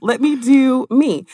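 mock-indignant "let me do me"; defiant pushback. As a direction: brush off the boss, slowing on the last line. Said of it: "And I'm just like".

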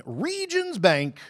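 high, drawn-out sung tagline; mock jingle tag. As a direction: stretch it like a bank jingle.

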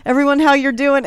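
retold holler at full volume. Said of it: "I hollered".

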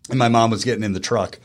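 normal talking voice

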